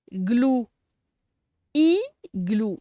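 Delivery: "Igloo" pulled apart as "i", long pause, "glou"; syllable by syllable. Shown as a demonstration. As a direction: separate the two halves of "Igloo" loudly. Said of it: "on va séparer les syllabes".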